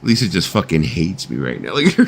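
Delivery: ordinary delivery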